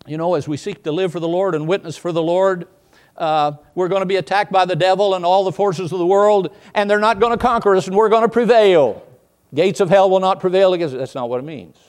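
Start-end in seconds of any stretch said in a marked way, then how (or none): 3.2-11.0 louder, higher, rising; preacher rousing the congregation; rousing preaching. Acted. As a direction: pump up the crowd with a triumphant claim. Mocks the view he rejects.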